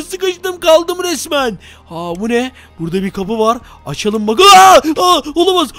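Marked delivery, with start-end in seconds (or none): 4.4-5.2 loud high scream; jump scare scream. Real, not acted.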